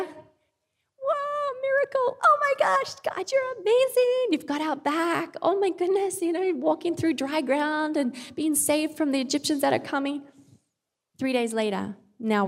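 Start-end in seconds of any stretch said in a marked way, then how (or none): 0.0-10.2 excited awe. Retold or imitated. Israelites after the Red Sea miracle.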